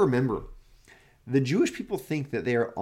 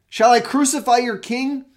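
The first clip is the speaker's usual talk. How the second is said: loud, much higher question; Pilate's challenge; 11 semitones higher, 8 dB louder than usual. Acted. Pilate calling out to the chief priests, loud and high. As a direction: fling the question out to the crowd.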